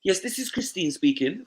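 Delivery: high, proper telephone voice for callers; posh phone voice. Retold or imitated. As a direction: put on the polite mum-on-the-phone voice. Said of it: "Pick up the phone. All of a sudden, who's that speaking?".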